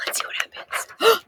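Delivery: high, hushed whisper; teacher whispering to the class, high and hushed. Real, not acted. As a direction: whisper it like a secret about to unfold. Building suspense for children.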